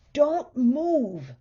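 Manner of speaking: husband ordering his family to stay still; shouting. A narrator's reading. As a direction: command everyone to freeze. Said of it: "shouted Mister Watson".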